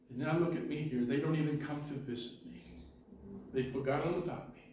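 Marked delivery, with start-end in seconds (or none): none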